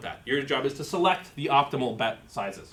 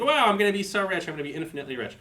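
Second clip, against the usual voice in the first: mock excitement; 7 semitones higher, faster than usual. Lecturer voicing a robot promised endless dollars, mock excited. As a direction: play an awestruck robot counting on riches.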